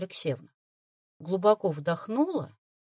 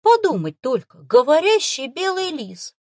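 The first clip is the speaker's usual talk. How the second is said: delighted; 7 semitones higher, 7 dB louder than usual. Acted. Boy marvels again at the fox, delighted. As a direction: marvel out loud. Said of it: "восторженно прошептал он вновь".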